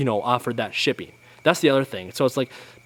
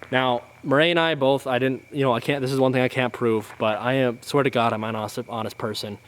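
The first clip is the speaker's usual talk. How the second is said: ordinary delivery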